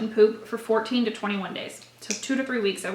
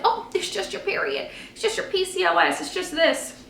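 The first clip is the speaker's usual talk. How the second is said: higher, airy brush-off, mimicking everyone; mock dismissive voice; 7 semitones higher than usual. Mimicking people brushing off her period pain, mock dismissive. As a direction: wave it away like it's nothing, in a put-on voice.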